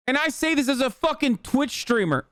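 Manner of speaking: ordinary talk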